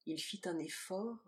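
ordinary delivery

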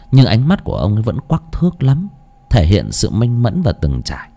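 ordinary delivery, nothing marked

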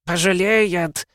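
high, slow shrill screech; shrill screeching bird woman. Acted. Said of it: "пронзительно заверещал Ветрогон". (пронзительный птичий визг)

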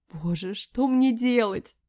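slow, high, anguished cry; loud, slow lament; hoarse despair. A narrator's reading. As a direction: drag out a despairing plea. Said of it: "прохрипела осипшим голосом".

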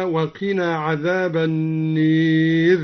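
slow chant, النار as "an-neer"; imala on النار. Shown as a demonstration. Teacher showing al-Duri's imala, chanted "an-neer" for النير. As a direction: draw out النير with alif tilted to "ee". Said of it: "وكذا أمال قوله تعالى".

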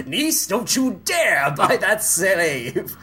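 hammed-up scolding, drawn out, then high-pitched defiance; slow pompous uncle, then squeaky defiant niece; mock uncle-niece quarrel. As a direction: play both sides of a melodramatic squabble.

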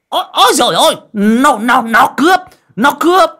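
panicked high, loud cry of robbery; shouting for help. A narrator's reading. Coffin maker crying robber to the neighbours, panicked shouting. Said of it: "miệng hô hoán".

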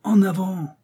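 high, drawn-out urging call; joyous cry. A narrator's reading. Joyful Joey urges the elephant onward, joyous high cry. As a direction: cheer the beast forward at a high pitch. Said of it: "s'écria le joyeux Joey".

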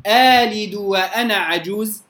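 آلد drawn out slowly on a long aa; slow, lengthened aa in آلد; long alif replacing hamza. Shown as a demonstration. Quran teacher demonstrating ibdal to students. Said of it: "ولنا الإبدال فيها".

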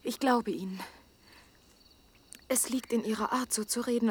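ordinary talk, nothing marked

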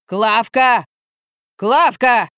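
acted drunken bellow, stressed first syllable; straining yell. A narrator's reading. Drunk man keeps calling Klavka from upstairs, straining bellow. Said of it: "надрывался Юрий".

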